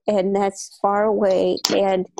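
ordinary talk

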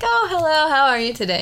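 high, bright put-on cheer covering sadness; teacher forcing a cheerful hello to a student; fake cheerful greeting. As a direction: sound breezy while hiding hurt. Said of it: "to pretend that I was okay".

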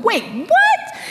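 very high, incredulous question; stunned double take. Retold or imitated. Mary turns and recognizes Jesus, comically high-pitched disbelief. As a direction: squeak it in mock astonishment. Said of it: "that was kind of the attitude".

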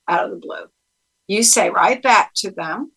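normal talking voice

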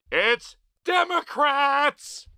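slow, high and loud; emphatic punchline. Real, not acted. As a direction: land it slowly and hard. Punchline after rhetorical no's.

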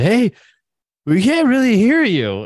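high, loud holler to the stage; retold calling out. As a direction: call up to the band, voice pitched high. Retold calling out to a band onstage.

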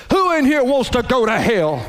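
hellfire preacher. Retold or imitated. Pastor challenging the kids after a hellfire sermon, imitated preacher shout. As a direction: fling the question out at the children. Visiting pastor voiced in story.

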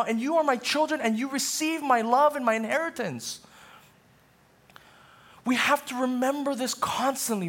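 high, slow, emphatic sermon voice; fervent preaching. Acted. Pastor urging the congregation about baptism, fervent preaching. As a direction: lean into every word like a preacher at full force.

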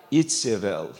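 Itzebel with ts and v, ITS-eh-vel; Hebrew name. Shown as a demonstration. Pastor correcting Jezebel to Hebrew: Itzebel with a ts sound. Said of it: "Isabel, Jezebel".

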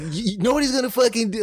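high mock drill instructor; drill sergeant voice. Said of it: "and he's like".

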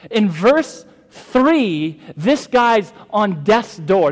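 loud, high, slow preaching emphasis; forceful preaching. Acted. Pastor expounding the psalm to the congregation, forceful preaching. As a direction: preach it out, drawing out each key word.

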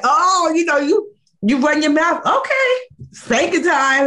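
high, slow scolding parent; mock strict parent. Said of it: "that's how I grew up".

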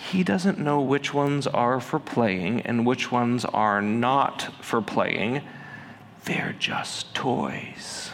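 calm him down gently, almost breathing the last words; very gentle voice. Retold or imitated. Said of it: "said in a very gentle voice".

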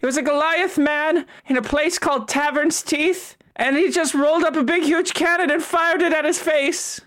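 high, lively storyteller NPC; character voice. Acted. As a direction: tell it like an excited tavern regular. NPC tells a tale in character.

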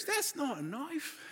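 soft, slow, high Australian bushman imitation; hushed playful Aussie film quote; Nick Dundee. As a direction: toss off the catchphrase quietly, stretched out. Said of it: "All of a sudden he goes".

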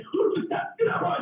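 high, breathy pleading; begging the crazy dog to stop; mock plea to a barking dog. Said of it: "I'm like".

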